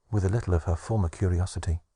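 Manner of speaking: usual speaking voice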